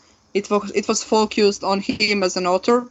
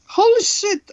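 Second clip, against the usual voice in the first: surprised; 13 semitones higher, 4 dB louder than usual. Real, not acted. Sudden realization mid-story.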